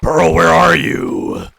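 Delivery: long, loud drawn-out call. Real, not acted. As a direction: call out across the island. Calling out for lost Pearl.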